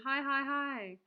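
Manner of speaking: quick, high, loud string of hi's; loud delighted triple hi; excited greeting. Real, not acted. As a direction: bounce the hi's at an arriving friend. Greeting a viewer by name.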